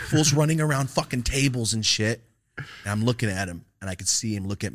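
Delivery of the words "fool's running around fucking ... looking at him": ordinary talk, nothing marked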